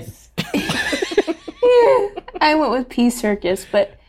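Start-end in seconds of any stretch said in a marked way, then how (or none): 0.4-2.4 high laugh. Real, not acted. Guest cracking up over Pee Circus, high laughter. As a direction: burst of high-pitched laughter.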